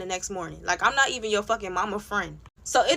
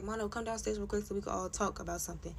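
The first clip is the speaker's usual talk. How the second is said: casual phone request; 2 semitones lower, 12 dB quieter, faster than usual. Retold or imitated. How the housemate should have phoned her for a talk, casual and quiet. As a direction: ask quietly, no fuss. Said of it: "Or she didn't call me on the phone like".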